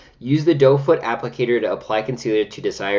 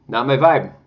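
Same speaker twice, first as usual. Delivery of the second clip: louder, higher punchy verdict on vibe; emphatic not my vibe; 4 semitones higher, 6 dB louder than usual. Shown as a demonstration. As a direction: slam it down as a final verdict.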